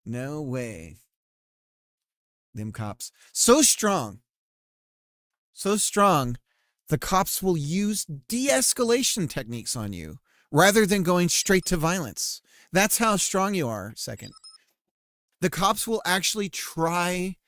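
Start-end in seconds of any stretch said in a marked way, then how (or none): 3.3-4.2 high, loud and epic; grand booming proclamation. Pretend. Booming answer to a chat message. 5.5-13.9 high, loud, slow proclamation; epic announcer voice. Pretend. Booming answer to a chat message.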